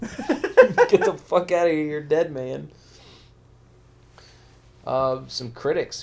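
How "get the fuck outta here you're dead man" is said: hammed-up angry threat; louder mock tough guy; mock angry yell. Acting out angry rabbi.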